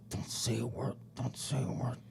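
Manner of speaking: urgent hushed whisper; whispered warning. Retold or imitated. Lawyer whispering to client in court, hushed whisper. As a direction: hiss it under your breath beside the judge.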